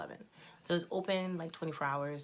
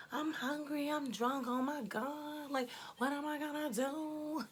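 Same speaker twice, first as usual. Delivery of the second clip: high, whiny drunk imitation; mocking a drunk friend yelling in his face; mock drunk girl; 10 semitones higher, slower than usual. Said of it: "I don't know why I sound like that".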